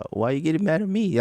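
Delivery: high, whiny mock indignation; hammed-up high whine of offense; mock indignant. Mocking critics' reaction.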